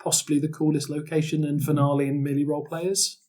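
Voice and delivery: normal talking voice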